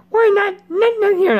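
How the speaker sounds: high squeaky put-on voice; comic high squeak; character voice. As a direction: pitch way up to play the character. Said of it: "I'm not gonna say that voice".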